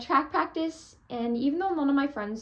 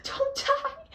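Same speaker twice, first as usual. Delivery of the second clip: high pleading cry; 6 dB louder than usual. Retold or imitated. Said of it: "I was almost crying".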